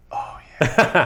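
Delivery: imitating a turned-on listener, breathy; high, airy mock-pleasure "oh, yeah"; mock swooning. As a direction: play hot and bothered over car talk. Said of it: "Scott's over here like".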